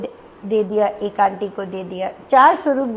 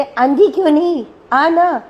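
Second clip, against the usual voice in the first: higher, louder call across the hall; 4 semitones higher, 5 dB louder than usual. Retold or imitated. Guru calling the aunty forward from the congregation, raised call. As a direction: call her over, a little raised and insistent. Guru calls her over again.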